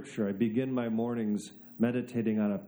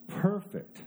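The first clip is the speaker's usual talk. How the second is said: mock salesman delight; 8 semitones higher, slower than usual. Showing off the coat holder.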